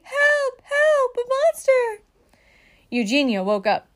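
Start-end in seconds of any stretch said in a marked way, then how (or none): 0.0-2.0 high, repeated cries for help; frantic storybook alarm; panicked shouting. A narrator's reading. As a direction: cry out for rescue in mock terror. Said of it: "shouted Baby".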